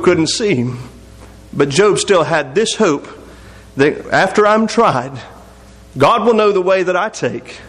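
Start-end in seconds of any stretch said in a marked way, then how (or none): none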